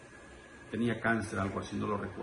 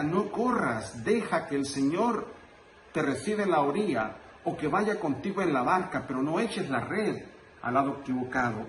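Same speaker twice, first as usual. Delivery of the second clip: raised, emphatic sermon delivery; fervent preaching; 5 semitones higher, 3 dB louder than usual. Real, not acted. Priest exhorting the brethren about resurrection, fervent preaching. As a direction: drive the message home to the congregation.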